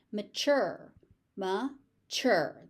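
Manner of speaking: "Mature" said as muh-CHUR. Shown as a demonstration. Teacher showing how TU sounds, ch sound. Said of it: "T U becomes a ch".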